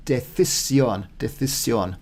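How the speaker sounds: "decisión" with a th sound for the c, "dethisión"; careful Castilian th, stress on the end; Castilian pronunciation. Shown as a demonstration. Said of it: "get your lips around that one".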